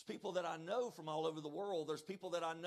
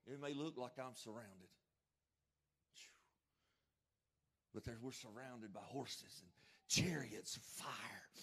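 hushed; 7 semitones lower, 5 dB quieter than usual. Real, not acted. Preacher drops to a low hush.

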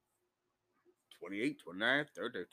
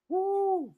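a high, sudden whoop; excited whoop; 13 semitones higher than usual. Real, not acted. Whooping high while telling the taco place story. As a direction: let out a gleeful woo.

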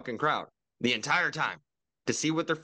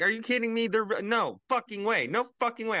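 much higher, exasperated outburst; pissed disbelief; 9 semitones higher than usual. Retold or imitated. Podcaster reliving his angry reaction to [name] news, exasperated. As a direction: cry out in outraged disbelief. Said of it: "I was like".

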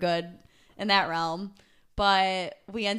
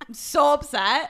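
higher, louder and slower, hammed-up dismay; mock upset; 8 semitones higher, 9 dB louder, slower than usual. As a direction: play the outrage for laughs. Mock complaint about border guard.

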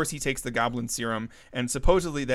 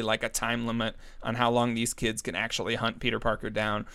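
ordinary delivery

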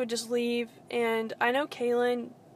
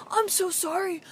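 mock fake-upset apology; 7 semitones higher than usual. Mocking his fake apology to Caitlyn, high and whiny. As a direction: parody a man pretending to be hurt. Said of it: "he's trying to act so upset".

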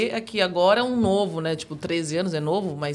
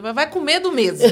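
laughing; 6 semitones higher, 7 dB louder than usual. Retold or imitated. Retelling her own brave advice.

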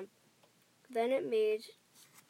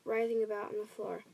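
usual speaking voice

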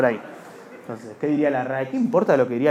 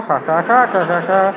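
loud, spelt-out fake laugh; forced "ha ha ha", hammed up; mock laughter; 3 semitones higher, 5 dB louder, slower than usual. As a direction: imitate a guffawing loudmouth. Mimics heckler telling dad joke, laughing.

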